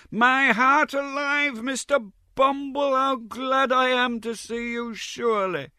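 very high, slow, fawning greeting; effusive Mrs Mann. A narrator's reading. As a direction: gush at him in a shrill sing-song.

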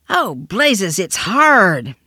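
exclaimed, with "hard" drawn out and loud; peculiar pronunciation. A narrator's reading. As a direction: cry out, leaning oddly on "hard". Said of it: "pronouncing the adjective in a peculiar manner".

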